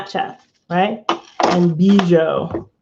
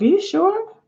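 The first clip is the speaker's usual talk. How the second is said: surprised; 12 semitones higher than usual. Retold or imitated. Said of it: "I was like".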